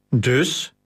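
mildly grumbled one-word challenge; understated grumpy query. A narrator's reading. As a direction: grumble it as a short question. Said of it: "bromde Gratus".

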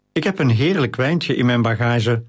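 plain reading voice

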